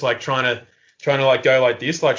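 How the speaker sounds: ordinary talk, nothing marked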